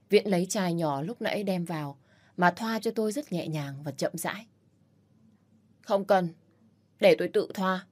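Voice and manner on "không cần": ordinary delivery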